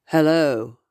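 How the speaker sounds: a lightly imitated deep man's greeting; mild mock deep spectral hello; deep male voice. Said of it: "this deep voice said".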